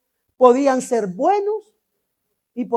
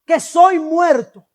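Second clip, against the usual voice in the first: prophet's lament; 3 semitones higher, 5 dB louder, slower than usual. Acted. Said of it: "el profeta Isaías dice".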